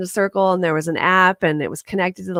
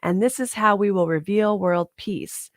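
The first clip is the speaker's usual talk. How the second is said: usual speaking voice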